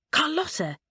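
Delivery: high, startled outburst of a name; remembering someone forgotten, with a jolt; sudden exclaim. Acted. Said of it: "he exclaimed".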